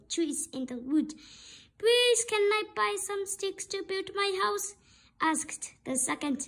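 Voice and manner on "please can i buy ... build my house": higher little pig voice; little pig asking. Acted. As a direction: ask politely as a small pig.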